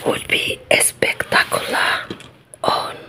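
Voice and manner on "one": plain reading voice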